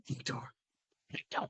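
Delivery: hushed, whispery command; very quietly. Acted. Player echoing the comic door command, very quietly. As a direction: barely voice it, very softly. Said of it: "But very quietly".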